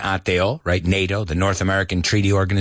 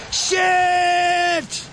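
high shouted curse; startled shout. Retold or imitated. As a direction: yell it as the tornado hits. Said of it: "And then he says".

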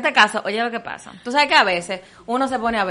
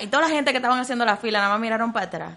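normal talking voice

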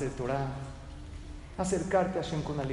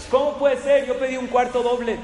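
loud, high outraged guest complaint; retold angry shouting; 7 semitones higher, 7 dB louder than usual. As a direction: shout it at the receptionist, indignant. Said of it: "gritándole".

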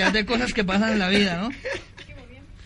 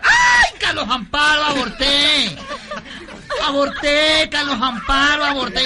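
high, loud campy wail, long first cry; hammed-up screaming; 7 semitones higher, 6 dB louder, much slower than usual. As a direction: shriek the news in mock horror. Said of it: "comenzó a gritar".

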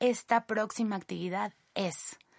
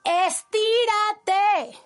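alargado, agudo y fuerte; grito estirado; 10 semitones higher, 7 dB louder, much slower than usual. Shown as a demonstration. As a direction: estira la palabra gritando. Grita la orden estirándola. (¡estíraaate! gritado y alargado)

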